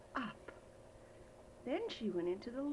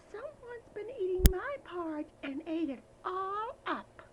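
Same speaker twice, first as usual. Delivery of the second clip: high, loud little bear; high, loud baby bear complaining; 10 semitones higher, 5 dB louder than usual. Acted. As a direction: squeak it out like a tiny cub. Baby Bear voiced in story.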